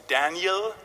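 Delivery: Daniel said in British Queen's English. Shown as a demonstration. Preacher showing the congregation English pronunciation, Queen's English Daniel. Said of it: "nicht so Queen's English".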